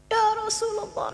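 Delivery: high, soft mock sobbing; imitated weeping. Old man weeps for the Prophet, imitated tearful cry. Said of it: "Nangis-nangis dia".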